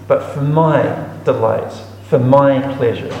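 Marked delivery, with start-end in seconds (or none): none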